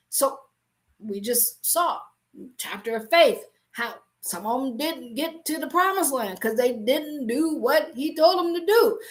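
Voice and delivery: ordinary talk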